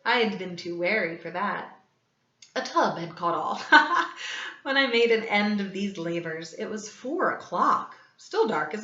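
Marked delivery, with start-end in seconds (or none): 3.7-4.6 breathy unvoiced gloating laugh; gleeful cackle. A narrator's reading. Killer cackling that a tub caught all. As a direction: cackle with mad delight.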